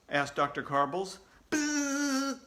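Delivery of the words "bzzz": nasal-free flat buzz imitating a quiz buzzer. A narrator's reading. Reader imitates the quiz buzzer, steady buzz. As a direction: make the buzzer noise with your lips.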